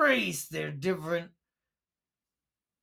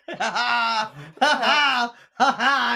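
slow hammy villain cackle; evil gloating laugh; 3 semitones higher, 5 dB louder, slower than usual. Acted. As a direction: cackle in triumph, drawn out. Character gloats after trick.

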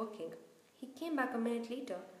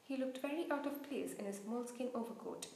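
ordinary delivery

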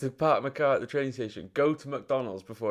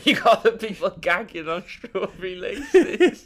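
louder, slower, laughing; chuckling through the punchline; 6 semitones higher, 6 dB louder, slower than usual. Real, not acted. Laughing at gagging image.

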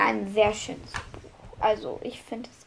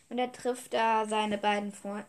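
normal talking voice

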